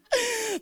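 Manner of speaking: high-pitched burst of laughter; real laugh. Real, not acted. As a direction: break up laughing mid-sentence. Breaks up laughing mid-quote.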